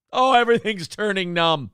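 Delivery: ordinary talk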